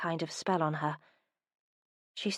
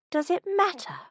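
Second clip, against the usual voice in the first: a much higher, outraged echo; incredulous echo; 12 semitones higher, 4 dB louder than usual. Acted. Minerva echoes his question, much higher and outraged. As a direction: throw his words back in disbelief.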